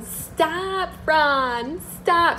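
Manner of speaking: high, pleading mock cry of stop; imitating Sam. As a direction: squeal Ron's name like a hammed-up reality star. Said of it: "Sam was always going".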